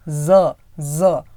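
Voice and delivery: "ज, ज" as two buzzed z sounds, low; isolated z sound. Shown as a demonstration. Teacher giving the dotted letter's sound, drawn z. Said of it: "वो sound क्या हो जाता है".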